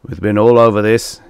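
loud, booming complaint; bassooned. A narrator's reading. Korn rebuking his wavering followers, bassooned. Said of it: "he bassooned".

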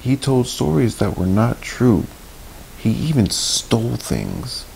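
plain reading voice

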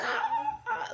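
high, squealing, laughing ahs; excited baby squeal. Retold or imitated. Mom imitating her son's squeal over crackers, very high. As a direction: squeal like a thrilled toddler. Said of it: "He's like".

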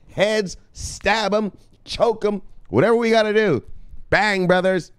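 high, fired-up war speech; rally them into battle. Acted. Pep talk as Spartan commander.

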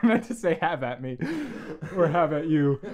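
talking through laughter; amused self-correction; laughing. Real, not acted. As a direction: giggle while correcting yourself. Laughing at own flub.

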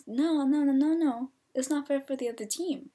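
insistent retold objection; firm refusal. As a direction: shut down the protest emphatically. Retells refusing the players' protest.

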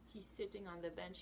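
ordinary delivery, nothing marked

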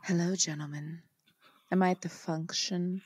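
soft whispered bedtime story voice; sleep story narrator. Pretend. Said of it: "let me get a little bedtime story voice".

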